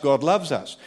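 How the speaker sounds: ordinary delivery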